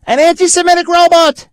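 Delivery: high, loud disbelieving cry; incredulous. Real, not acted. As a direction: exclaim it in sheer disbelief. Incredulous exclamation, much higher.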